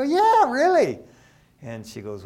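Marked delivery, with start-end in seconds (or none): 0.0-1.0 high, loud excited yelp; a giddy, loud yes to his wife. Retold or imitated. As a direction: burst out with the good news.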